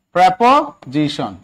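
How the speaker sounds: প্রিপোজিশন slowly broken up as "pre-po... ji-shon"; teacher slowly modelling প্রিপোজিশন as "pre-po-jishon"; careful pronunciation. Shown as a demonstration. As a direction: sound out প্রিপোজিশন slowly in parts, opening with "pre". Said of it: "এটার উচ্চারণ কিন্তু প্রিপোজিশন".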